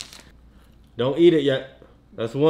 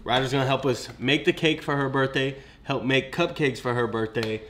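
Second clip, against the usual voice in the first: ordinary talk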